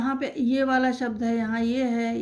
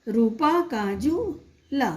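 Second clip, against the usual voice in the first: slow reading; much slower than usual. Shown as a demonstration. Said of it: "reads sentence slowly to kids".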